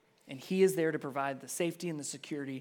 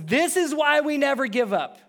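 Paul's declaration; 9 semitones higher, 8 dB louder than usual. Acted. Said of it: "Paul says".

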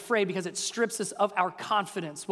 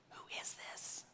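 hushed, breathless wonder; awed whisper; 21 dB quieter than usual. Acted. Disciples whispering to each other in awe.

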